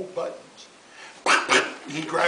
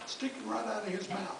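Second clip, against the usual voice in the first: ordinary delivery, nothing marked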